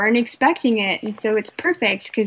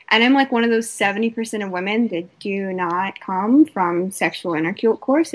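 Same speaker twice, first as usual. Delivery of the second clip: ordinary delivery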